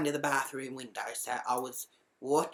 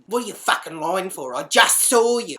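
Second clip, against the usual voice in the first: raised, louder accusation building up; angry challenge; 3 semitones higher, 8 dB louder than usual. Retold or imitated. Catching the housemate lying about the shower, angry challenge. Row through bathroom door.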